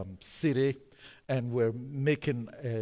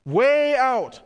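loud, high preaching cry; emphatic shout; 10 semitones higher, 11 dB louder than usual. Acted. Preacher drives home their fate, loud. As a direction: drive the point home loudly.

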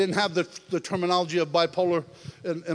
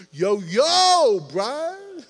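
casual street voice; 8 semitones higher, 7 dB louder, much slower than usual. Pretend. God greeting the preacher casually, loud and high. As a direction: greet him like a buddy calling out. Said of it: "in the [name] translation".

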